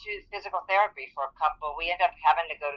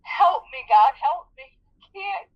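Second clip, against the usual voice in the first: much higher, sobbing pleas; crying to God. Retold or imitated. Woman in chronic pain cries out to God, sobbing. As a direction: cry out to God through tears. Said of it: "Crying like a baby".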